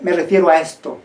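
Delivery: ordinary talk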